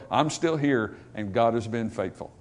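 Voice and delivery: usual speaking voice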